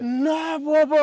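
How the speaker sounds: slow, drawn-out no way; drawn-out stunned disbelief. Acted. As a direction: let the shock sink in slowly. Disbelief at revelation.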